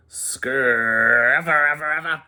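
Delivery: loud rumbling snoring noise; a princess snoring like thunder; thundering snore. Acted. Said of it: "she thundered".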